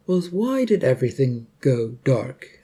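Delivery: plain reading voice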